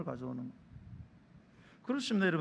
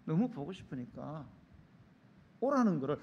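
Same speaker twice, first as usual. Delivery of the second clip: usual speaking voice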